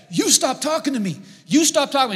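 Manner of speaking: high, loud mock yelling match; retold yelling. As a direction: yell it back like an angry stranger. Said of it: "are yelling at each other".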